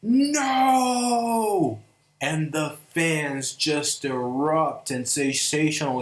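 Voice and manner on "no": a quivering brrr, then a high, loud, drawn-out no; shuddering mock yell. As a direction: shiver it off, then shout the no up high. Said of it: "And he's like".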